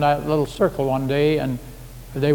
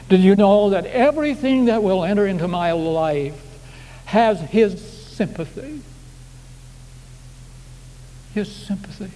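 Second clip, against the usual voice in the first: rising preaching fervour; 5 semitones higher than usual. Acted. Preacher pleading with the congregation, rising then hushed. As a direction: build the appeal, then let it fall.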